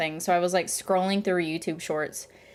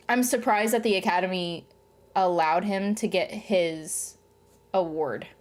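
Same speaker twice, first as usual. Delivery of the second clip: usual speaking voice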